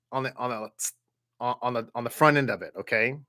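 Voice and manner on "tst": a short ts click; tst. Shown as a demonstration. Teacher demonstrating the ts sound at the front. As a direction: snap out a quick tst. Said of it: "You put a little tst".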